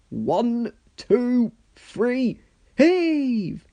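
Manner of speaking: rally them to pull; counting heave. Acted. Sir Topham Hatt counting the rescue pull, building shout.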